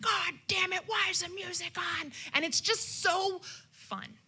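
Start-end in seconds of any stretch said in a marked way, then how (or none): 0.0-2.1 grumpy grandpa. Retold or imitated. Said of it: "my Grandpa George is like".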